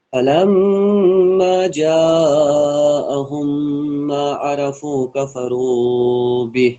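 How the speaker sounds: slow melodic tajweed recitation; slow chanted Quran verse. Acted. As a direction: draw each syllable out in chant.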